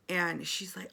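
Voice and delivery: usual speaking voice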